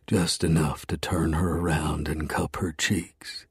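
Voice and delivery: ordinary delivery, nothing marked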